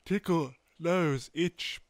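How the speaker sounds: high, yawn-stretched words; talking through a yawn. Real, not acted. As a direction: push the words out mid-yawn. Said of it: "and then yawning makes it even worse".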